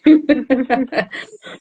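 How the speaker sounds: light, rippling laugh; amused laugh. Real, not acted. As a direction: laugh at your own wry joke. Laughs after joking about internet nutritionists. (risada leve e divertida)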